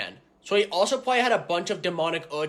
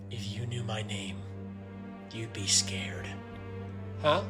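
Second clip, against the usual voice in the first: low, brooding edgelord voice; dark and dramatic, almost whispered; edgy dark hero; 5 semitones lower than usual. Acted. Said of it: "may call me Salamancorius, the Dark Angel".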